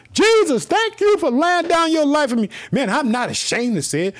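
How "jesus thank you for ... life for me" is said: high, ringing shout of thanks; shouted praise. Real, not acted. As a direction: shout it out to heaven, unashamed. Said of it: "I shout it".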